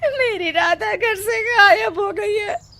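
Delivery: wailing mother, sob through the words; a frantic mother crying for her lost daughter; tearful cry for help. Acted. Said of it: "Radha की माँ रोते रोते आती है".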